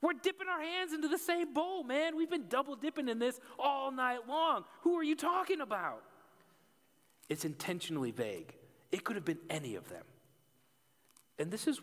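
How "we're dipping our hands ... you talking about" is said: high, excited mock disciple voice; bewildered disciples. Disciples at supper, bewildered high voice. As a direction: play the baffled friends at the table. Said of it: "the disciples are like".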